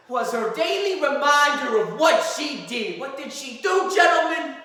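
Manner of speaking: high, loud, slow courtroom climax; impassioned shouting. Acted. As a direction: thunder it at the jury. Climax of the closing argument.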